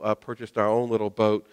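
ordinary delivery

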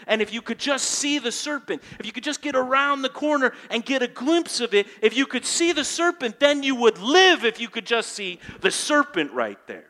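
rising from earnest to loud, high preaching; climbing sermon fervour; building preaching. Acted. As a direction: build the urgency line by line.

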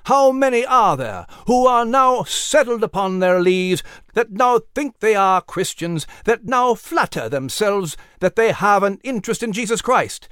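put-on fervent old-time sermon voice; preaching. A narrator's reading. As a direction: preach it to a packed field.

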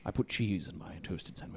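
low, soft suave actor voice; mock-seductive star in a deep murmur; Benedict Cumberbatch. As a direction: ask it low and intimate, as a sultry impression. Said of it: "Watch too much Sherlock".

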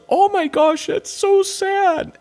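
high, slow, teary imitation of his wife; mock tearful sympathy. Wife on the sofa moved by the show, high teary mock voice. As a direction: mimic the wife gushing over a sad scene. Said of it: "and she goes".

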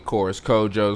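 usual speaking voice

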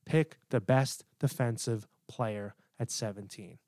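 very slow, soft, spaced-out "Pick the best defensive player"; low-key but firm, each word of "best defensive player" set apart; from the chest, with conviction. Shown as a demonstration. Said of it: "Say it with your chest".

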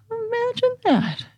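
wry mock surprise. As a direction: tease them with a knowing high voice. Wry reply to student.

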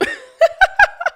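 loud high burst of laughter. Real, not acted. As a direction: crack up loudly at the joke. Laughing at the baby meme.

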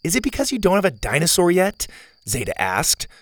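a hint of a spunky girl; Zeta girl voice.

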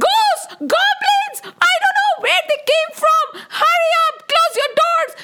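high-pitched, frantic shouts of alarm; hammed-up hysterical terror; panicked cries. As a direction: scream warnings to a fleeing crowd. Townspeople flee from ghosts and goblins.

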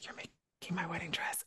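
breathless whispered disbelief. Retold or imitated. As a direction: barely voice it, overwhelmed. Emotional retold reaction, whispered.

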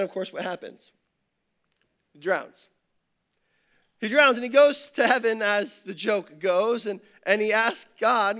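ordinary talk

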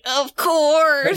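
lightly acted seething agreement; grudging, drawn-out imitation of fuming; mock fuming. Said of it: "Veronica's just fuming, saying".

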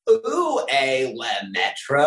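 put-on loud, drawn-out French in American accent; exaggerated American accent, slow and loud; American tourist French. Mimicking American tourists attempting French.

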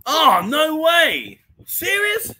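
loud, high, drawn-out disbelief; stunned, voice leaping right up; real amazement. Real, not acted. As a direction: burst out in astonished delight. Amazed reaction to chat news.

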